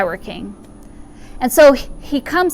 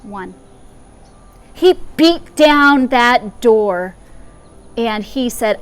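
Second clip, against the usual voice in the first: ordinary delivery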